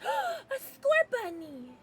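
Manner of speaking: high, drawn-out squeal of delight; excited gasp. Real, not acted. Gasps on spotting a favourite Pokemon.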